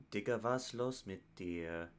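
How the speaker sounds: slow, soft, lightly voiced German line; a hint of the female hook voice, slowed; quoted German hook. Reacting to a rap song, quoting the hook softly. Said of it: "[name] and [name] have done that".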